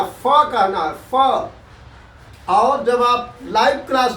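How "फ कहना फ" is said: "फ" with lower lip pressed to teeth; Urdu fa. Shown as a demonstration. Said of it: "नीचे के होंठ पर दांत को दबा करके फ कहिए". ("फ़" दांत को होंठ पर दबाकर)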